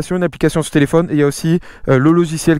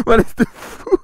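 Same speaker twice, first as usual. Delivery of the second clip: laughing; 8 semitones higher than usual. Real, not acted. Laughing greeting to a passing rider.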